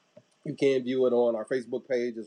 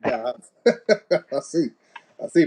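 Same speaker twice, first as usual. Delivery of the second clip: laughing; 4 semitones higher, 7 dB louder than usual. Real, not acted. Laughs at cousin's joke.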